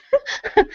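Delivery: short high-pitched laugh; quick bright laugh. Real, not acted. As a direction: laugh at her own line. Guest laughs after her joke.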